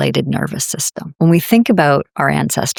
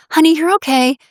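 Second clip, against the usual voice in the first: put-on much higher, sing-song reassurance to a child; mock parent comforting over hidden stress; forced soothing; 9 semitones higher than usual. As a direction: soothe a fallen kid in a bright high voice. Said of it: "you're like".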